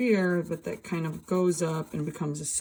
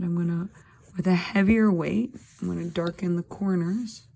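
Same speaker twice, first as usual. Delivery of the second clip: normal talking voice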